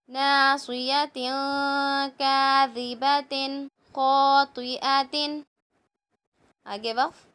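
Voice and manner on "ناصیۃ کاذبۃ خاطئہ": slow, drawn-out tajweed reading; slow recitation. Shown as a demonstration. Teacher slowly reciting the words for the pupils. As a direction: lay each syllable down slowly for the class. Recites phrase while building it.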